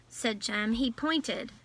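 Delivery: plain reading voice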